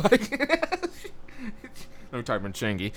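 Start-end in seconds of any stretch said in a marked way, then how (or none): 0.4-2.1 high laugh. Real, not acted. Big laugh.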